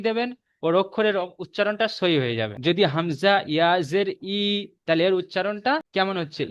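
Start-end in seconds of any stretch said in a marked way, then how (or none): 4.3-4.4 "ই" held long and steady; long drawn-out vowel. Shown as a demonstration. Teacher spelling hamza-ya for a student, "ই" drawn out long. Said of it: "ই বলে টানছেন".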